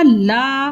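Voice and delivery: "ला" with the आ held long; full, long vowel. Shown as a demonstration. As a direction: draw "ला" out to its full length. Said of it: "पूरा बोल रहे हैं ना". ("ला" को खींच के पूरा बोलें)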